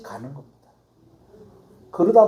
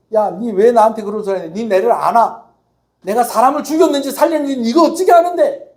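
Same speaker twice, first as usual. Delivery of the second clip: louder, higher indignant snapping back; angry retort; 4 semitones higher, 6 dB louder than usual. Retold or imitated. Resentful believer snapping back at someone who judged him, indignant and loud. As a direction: hurl it back at the accuser, offended.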